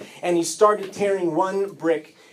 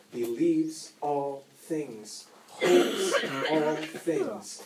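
closing sermon; 4 semitones lower, 5 dB quieter than usual. Acted.

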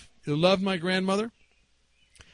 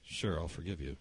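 very low, soft murmured reply; quiet forgiveness; 11 semitones lower, 14 dB quieter than usual. Retold or imitated. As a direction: answer under your breath in church. Quiet answer to deaf grandfather.

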